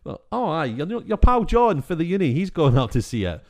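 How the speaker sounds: a loud, high, hammed-up Glaswegian pal bragging; mock Glaswegian local. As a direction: play the cheery know-it-all local, loud and high. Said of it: "It's like".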